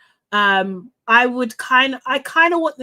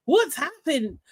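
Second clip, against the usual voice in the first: mock alarm; 4 semitones higher than usual. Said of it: "And I was just like".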